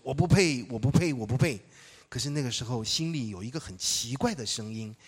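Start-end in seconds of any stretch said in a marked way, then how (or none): 0.0-1.6 loud, urgent repeated cry; loud response. Retold or imitated. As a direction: cry out your unworthiness loudly. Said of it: "大声的回应说". (大声呼喊)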